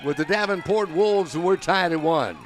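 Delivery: loud, excited home run call. Real, not acted. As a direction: build it up and shout the homer. Home run called in live broadcast.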